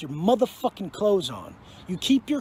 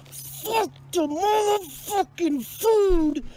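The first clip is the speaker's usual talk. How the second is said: explosive outburst; 8 semitones higher, much slower than usual. Real, not acted. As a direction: spit each word out, drawn long. Explosive prison rant.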